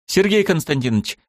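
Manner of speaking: put-on eager girl's call. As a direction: cut in eagerly to grab his attention. Student cuts in, calling him.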